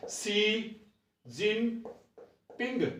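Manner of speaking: जिनपिंग stretched slowly and softly, pitch falling; slow drawn name. Shown as a demonstration. Teacher spells the president's name softly for students. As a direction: draw out शी जिनपिंग syllable by syllable. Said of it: "चीन का राष्ट्रपति कौन है".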